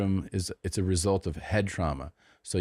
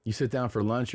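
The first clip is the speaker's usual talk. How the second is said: usual speaking voice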